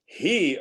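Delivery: He punched high and loud; stress on He. Shown as a demonstration. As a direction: hit He for comparison. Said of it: "when a person hits the pronoun".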